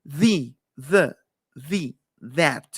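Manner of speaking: tongue between teeth for "the"; English th sound. Shown as a demonstration.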